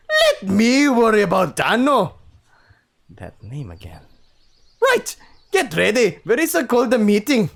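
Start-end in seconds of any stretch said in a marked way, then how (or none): 0.1-2.1 loud, high, slow attempt at Scottish; booming stab at a Scottish accent. Old wolf sniffing the room, attempted Scottish accent. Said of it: "give him a Scottish accent. I'll try". 4.8-7.5 loud, raised-pitch Scottish attempt; a try at a Scottish father; Scottish accent. Chief to his son as a father, attempted Scottish accent. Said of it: "give him a Scottish accent. I'll try".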